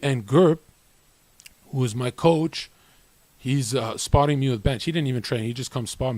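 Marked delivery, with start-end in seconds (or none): none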